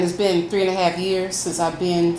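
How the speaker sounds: normal talking voice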